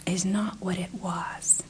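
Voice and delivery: hushed whisper to the producer; conspiratorial whisper. Acted. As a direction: drop to a secret whisper.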